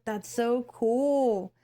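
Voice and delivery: imitating an awestruck new dad; amazed. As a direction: stretch it slowly in wonder. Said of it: "he was just so amazed by everything".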